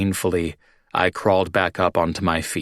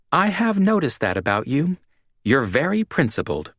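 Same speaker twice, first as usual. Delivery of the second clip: dry mock praise, pitched up on the first line; sarcastic; 8 semitones higher than usual. A narrator's reading. Jean mocks drunk Eric's principles, sarcastic. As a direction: needle him with fake admiration. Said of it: "Don't sound so sarcastic".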